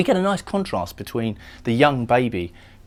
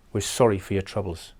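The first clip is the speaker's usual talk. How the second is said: put-on Northern Irish accent. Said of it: "Northern Irish accent".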